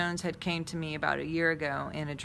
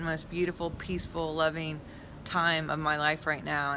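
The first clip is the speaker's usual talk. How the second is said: normal talking voice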